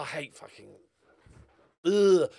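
ordinary talk, nothing marked

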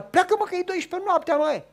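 loud, high, shooing off a pest; irritated shout through the door; annoyed neighbour. Acted. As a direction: chase him away from the window. Angry neighbour at midnight.

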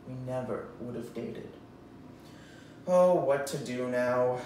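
normal talking voice

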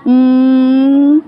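drawn-out, level "hmm"; prolonged pondering hmm; long thinking hum. Pretend. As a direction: hum slowly as if pondering the answer. Teacher pretends to think of a letter.

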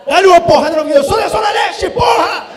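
shouted high and loud, fired up; mock battle yell. Dad yelling to son at Disney, roaring battle cry. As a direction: bellow it like a street fighter. Dad's advice, Zona Leste yell.

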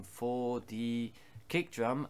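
usual speaking voice

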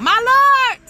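my lord cried out high and loud; fervent cry. Real, not acted. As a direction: burst out in fervent praise. Preacher's exclamation mid-sermon.

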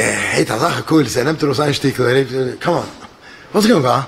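ordinary talk

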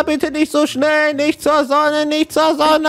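hoch und flehend protestieren; gespielte Panik. Acted. Wird panisch zur Sonne getragen. (gespielt panisches Flehen)

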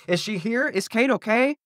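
high, frantic questioning without much volume; shouted question. A narrator's reading. Jenny runs to her parents, frantic half-shout. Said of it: "she shouted".

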